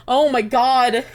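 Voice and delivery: loud overwhelmed cry; loud emotional outburst. Real, not acted. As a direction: burst out in real emotion.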